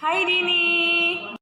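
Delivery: slow, high call across a distance; high drawn-out hello call. Acted. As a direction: stretch the hi to reach a friend far off. Calling out to friend.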